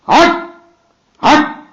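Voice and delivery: sharp loud shoo at a dog; shooing shout. Real, not acted. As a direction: chase the dog off with a yell.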